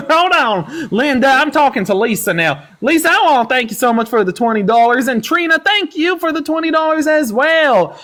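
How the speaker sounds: hammy country twang to the chat; put-on country accent. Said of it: "whatever accent that was".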